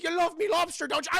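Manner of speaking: put-on high, loud old sea captain voice; taunting old salt voice; Willem Dafoe in The Lighthouse. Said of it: "he's like".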